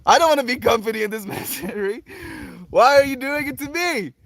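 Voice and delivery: loud, high-pitched comic outburst; mock anguished wail. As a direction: wail it in hammed-up despair. Mock outburst about being misery's company.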